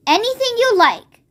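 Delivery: a touch of pride; the giant boasts of his dream-making; proudly. A narrator's reading. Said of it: "the B F G said proudly".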